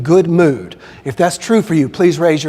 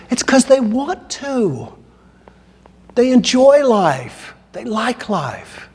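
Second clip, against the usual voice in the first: enthusiastic answer; 7 semitones higher, slower than usual. Retold or imitated. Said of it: "and I said".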